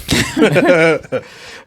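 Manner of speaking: loud high laughter; cracking up loud. Real, not acted. Laughing at his friend's accent excuse, loud laugh.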